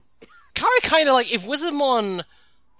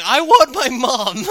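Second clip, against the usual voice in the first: louder, slow, hammed-up wail; mock bawling; 5 semitones higher, 7 dB louder, slower than usual. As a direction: fake crying for mom. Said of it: "just fucking starts bawling".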